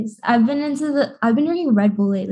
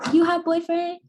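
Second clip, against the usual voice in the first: higher, softer nail salon worker voice; 6 semitones higher, 7 dB quieter than usual. Retold or imitated. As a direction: imitate a nail technician asking, pitched up and soft. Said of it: "you go to the nail salon".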